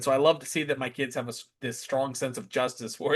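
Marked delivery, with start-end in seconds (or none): none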